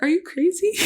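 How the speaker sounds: high, shocked disbelief; incredulous retort. Retold or imitated. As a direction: fire it back high, can't believe he said it. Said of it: "And I'm like".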